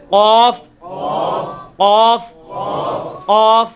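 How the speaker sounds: drawn-out booming قاف for repeating; back-of-tongue qaf. Shown as a demonstration. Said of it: "ابھی آپ practice کریں".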